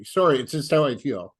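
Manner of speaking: ordinary talk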